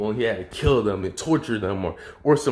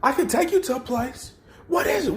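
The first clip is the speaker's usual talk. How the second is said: mock scream.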